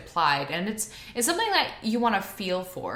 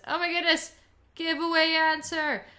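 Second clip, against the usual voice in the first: surprised; 9 semitones higher, 4 dB louder than usual. Real, not acted. Caught showing the answer.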